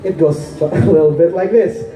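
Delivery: measured, explanatory poetry recital; spoken-word poem. Acted. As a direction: recite it like a stage poem, stressing key words.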